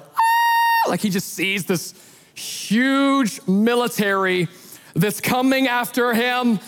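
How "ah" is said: sudden high shout of alarm; startled scream. Retold or imitated. Servant sees the army at night, screams. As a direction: scream like you just saw an army. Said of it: "and he's like".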